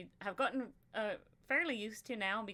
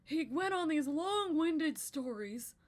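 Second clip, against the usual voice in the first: put-on breathless old storyteller, much higher; long-winded old man; 7 semitones higher, 8 dB louder than usual. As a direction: drag it out like a rambling grandpa. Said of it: "he just talked like this".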